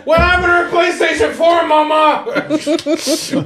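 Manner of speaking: put-on a kid yelling for mama. Kid pleading to parent, joke.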